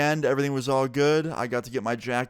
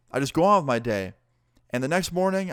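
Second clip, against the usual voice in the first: normal talking voice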